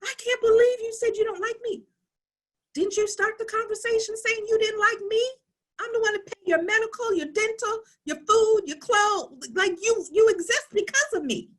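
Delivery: high, hurt mock outrage; mother protesting to her teen daughter, high and indignant; indignant mom. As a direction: pile on the list in shocked disbelief. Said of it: "my daughter was shocked when I told her".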